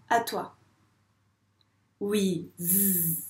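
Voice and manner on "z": "Z" as a long low buzz; son Z isolé. Shown as a demonstration. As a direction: sustain "Z" as zzz, no vowel. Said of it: "dire le son". (« Z » prononcé zzz, tenu)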